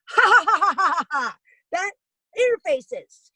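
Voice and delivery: high gloating ha-ha; taunting laugh at the uncles; mocking laugh. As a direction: laugh it in their faces. Gloating at Trump-supporting uncles.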